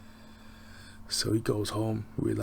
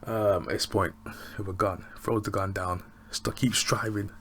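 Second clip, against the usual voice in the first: ordinary talk, nothing marked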